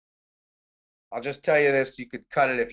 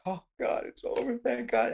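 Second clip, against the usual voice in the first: soft, high mock weeping; imitated weeping relief; 6 semitones higher, 5 dB quieter than usual. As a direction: re-enact tearful relief in a small raised voice. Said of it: "start to weep like a baby".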